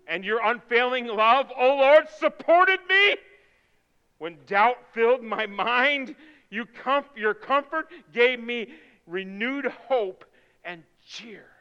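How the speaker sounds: loud, high, slow proclamation; fervent scripture reading. Acted. Pastor reading the psalm of rescue, fervent proclamation.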